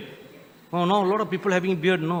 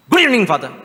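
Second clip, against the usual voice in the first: imitating auntie; 5 semitones higher, 7 dB louder, much faster than usual. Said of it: "and she said".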